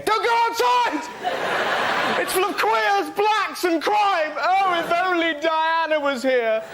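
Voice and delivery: high, loud mock-hysterical shouting; panicked headline. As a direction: shriek the scaremongering as a tabloid would. Just have every day.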